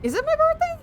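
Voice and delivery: high, squeaky puzzled question; hammed-up confused squeal; mock surprise. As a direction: pitch shoots way up in feigned disbelief. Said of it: "then I'll be like".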